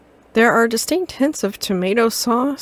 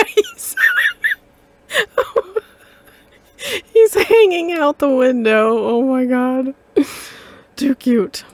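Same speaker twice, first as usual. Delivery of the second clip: much higher, laughing through the words; laughing delight; 6 semitones higher than usual. Real, not acted. Player sees dog hanging out window, laughing delight. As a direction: giggle helplessly at the dog.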